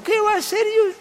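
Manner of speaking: very high-pitched mock protest; protesting innocence. Lizard reassures the wary boy, protesting innocence. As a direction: leap way up in pitch, acting innocent.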